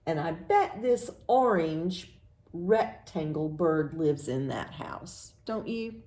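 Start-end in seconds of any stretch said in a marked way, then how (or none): none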